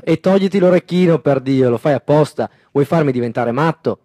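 loud furious father; angry shout. A narrator's reading. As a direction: yell it in his face. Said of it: "che gli grida in faccia".

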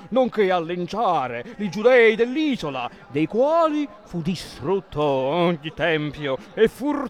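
slow, loud swaggering captain; Matamoros voice. Acted. Capitan Matamoros holding forth to the priest in a slow boastful voice. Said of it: "una voce da Matamoros".

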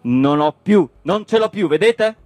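worked-up, high-pitched harangue; indignant tirade. Acted. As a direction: rant in outraged exasperation. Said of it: "indignato sul muso".

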